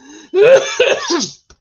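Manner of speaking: real loud choking cough; harsh real coughs; coughing fit. Real, not acted. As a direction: hack after swallowing wrong. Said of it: "Swallowed wrong".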